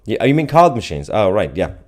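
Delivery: ordinary talk, nothing marked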